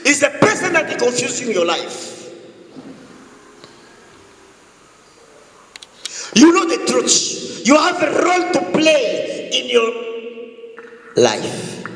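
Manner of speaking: high, ringing preacher's cry; forceful preaching. Acted. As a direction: preach it out to the whole church at full force.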